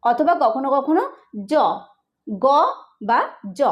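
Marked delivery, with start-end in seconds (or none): 1.5-1.5 G's j-sound জ, isolated; letter-sound demonstration. Shown as a demonstration. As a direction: sound out জ after a pause. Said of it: "জিতে উচ্চারণ হয়".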